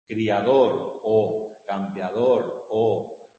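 loud, slow stress on every "O" in the words, one by one; hitting each vowel. Shown as a demonstration. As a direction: punch out each "O" for the class. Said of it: "la O es la que se sigue repitiendo".

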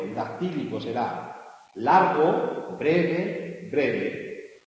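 teacher chanting long short short; dactyl beat. Shown as a demonstration. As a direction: count out the dactyl. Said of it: "En latín, o en griego".

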